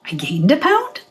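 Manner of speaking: puzzled question climbing at the end; mock disbelief. Gym-goer reading the scale, in mock disbelief. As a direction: question it in small-voiced disbelief.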